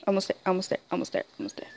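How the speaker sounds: soft, held-breath chant; will it through under the breath; tense whisper. Real, not acted. Hushed tension before success.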